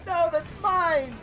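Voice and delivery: put-on childish cry of ownership; mock protest. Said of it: "He's like".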